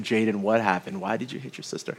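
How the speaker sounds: low, soft fatherly questioning; a patient father gently asking his son; calm dad. Retold or imitated. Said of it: "And I said".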